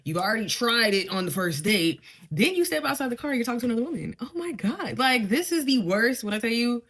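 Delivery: loud, fast, indignant rant; real exasperated rant. Real, not acted. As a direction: vent in disbelief. Exasperated recap of the date.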